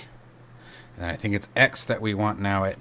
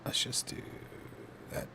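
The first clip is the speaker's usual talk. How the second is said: whisper; 19 dB quieter than usual. Real, not acted. Concentrating on a fiddly step.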